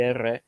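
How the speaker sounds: R said as Spanish "erre" with a trill; rolled Spanish R. Shown as a demonstration. Recalling the wish to learn it, R as a trilled "erre". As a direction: roll the tongue on "R", as the Spanish letter name. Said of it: "I wanna learn how to do".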